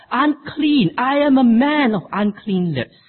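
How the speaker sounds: sudden high cry of unclean. Acted. Isaiah crying out before the holy king, high anguished cry. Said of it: "all he can do is cry out".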